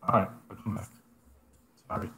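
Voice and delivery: ordinary delivery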